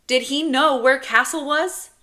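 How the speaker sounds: put-on high, loud little boy voice; nagging child. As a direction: pester like an ignored kid. Child James nagging ignored brother.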